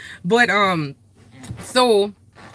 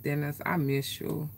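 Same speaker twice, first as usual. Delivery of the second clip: soft missing him; 2 semitones lower, 10 dB quieter than usual. Retold or imitated. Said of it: "I said".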